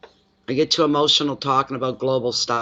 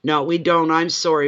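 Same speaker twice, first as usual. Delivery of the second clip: usual speaking voice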